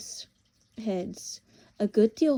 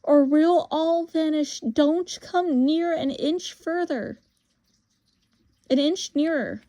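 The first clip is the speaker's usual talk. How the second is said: high, raised-voice threat; crying out a warning; 7 semitones higher, 5 dB louder than usual. Acted. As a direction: warn off a pursuer, loud and high. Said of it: "cried Diggory".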